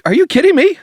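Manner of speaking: high, loud mock outrage; incredulous protest. Retold outraged reaction to Philemon.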